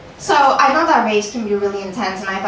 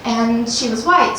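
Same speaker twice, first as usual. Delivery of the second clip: usual speaking voice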